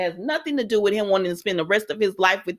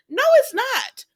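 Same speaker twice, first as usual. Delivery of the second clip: much higher, sharp outraged denial; indignant no. Real, not acted. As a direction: shut that idea down, outraged. Arguing with a character.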